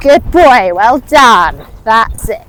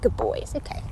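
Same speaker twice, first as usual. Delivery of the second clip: soft breathless soothing; calm him down gently, near a whisper; cooing to a scared pet; 14 dB quieter than usual. Real, not acted. After a fall, soothing horse.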